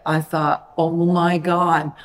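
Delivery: normal talking voice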